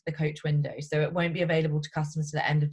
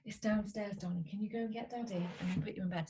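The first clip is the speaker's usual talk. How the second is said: hushed, gentle mum voice; tucking in at bedtime; 2 semitones higher, 12 dB quieter than usual. Real, not acted. Soft mum to son.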